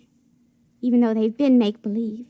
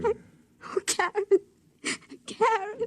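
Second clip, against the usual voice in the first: sobbing out her friend's name, high and soft; breaking into tears; 8 semitones higher, 6 dB quieter, slower than usual. Acted. Jilted woman crying to her friend. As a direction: let the tears win as you call for her. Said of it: "Just let 'em flow".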